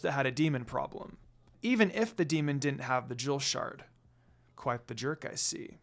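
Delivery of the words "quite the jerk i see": soft, breathy low murmur. Acted. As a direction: mutter it under your breath. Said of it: "Sesshomaru murmured".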